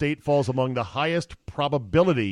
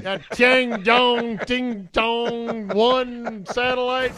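high, very slow mocking drawl; mock exaggerated voice; 9 semitones higher, much slower than usual. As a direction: stretch the name out, hammed up. Mocking Chinese name slowly.

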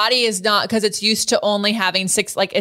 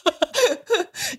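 high laugh; 12 semitones higher than usual. Real, not acted. Host laughing at guest's bus crying story, high laugh. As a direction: burst of high-pitched laughter. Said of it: "host laughs at story".

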